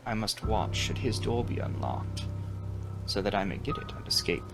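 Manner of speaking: plain reading voice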